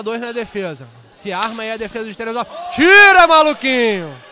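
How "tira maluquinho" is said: high, loud cheering shout; excited shout. Real, not acted. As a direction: yell it out as he clears. Save cheered during the match.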